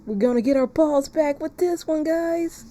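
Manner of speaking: rally the chat, pitch rising up; hyped shout-out. Real, not acted. Streamer rallying chat, excited and high.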